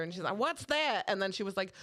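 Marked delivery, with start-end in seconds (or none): 0.4-1.0 higher, baffled mock Southern; puzzled Southern lady impersonation; Southern accent. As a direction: imitate a clueless Southern customer. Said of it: "I did the accent".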